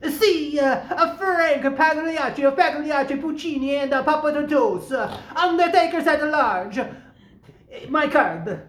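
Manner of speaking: showy fake Italian introduction; put-on Italian accent with filler sounds. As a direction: introduce yourself as a bluffing Italian. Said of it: "Italian accent".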